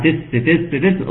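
تس with an added hissing س after the ت; wrong hissed ت. Shown as a demonstration. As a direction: hiss the ت into تس. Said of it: "وخطأ، خطأ، خطأ". (نطق خاطئ للتاء بصفير السين)

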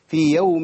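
في يوم said with a nasal ghunna; ghunna demo. Shown as a demonstration. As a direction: push في يوم through the nose. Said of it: "عملت غنة". (في يوم بغنة واضحة)

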